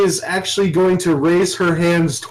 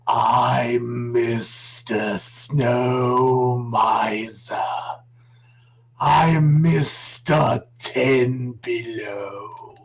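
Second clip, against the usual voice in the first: slow, low creepy snake singing; drawn-out lispy snake croon; 7 semitones lower, 4 dB quieter, much slower than usual. Acted. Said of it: "lispy snake voice".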